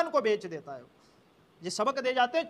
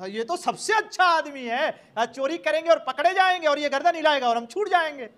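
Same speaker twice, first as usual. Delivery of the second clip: gleeful thief; 4 semitones higher, 5 dB louder than usual. Acted. Thieves delighted by king's skill.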